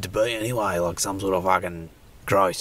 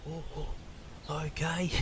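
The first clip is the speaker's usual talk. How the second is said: high unvoiced whisper, much softer; 8 semitones higher, 12 dB quieter than usual. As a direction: whisper so he won't hear. Whisper near the creature.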